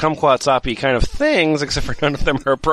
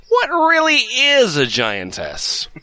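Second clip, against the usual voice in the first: dramatic announcer; 8 semitones higher than usual. Shown as a demonstration. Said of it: "[name], take this one".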